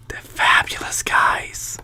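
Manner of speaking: whispered joke to viewers; hushed aside; soft whisper. Real, not acted. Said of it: "aside to viewers".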